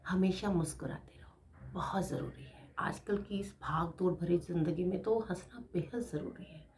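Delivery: soft, secret-like whisper; intimate whisper. Real, not acted. Sharing her motto with viewers in a whisper.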